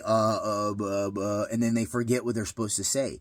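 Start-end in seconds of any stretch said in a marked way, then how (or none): 0.1-1.5 imitated nervous stutter; mock stammering. Shown as a demonstration. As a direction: stall on broken syllables, losing the words. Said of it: "if they stutter, if they say".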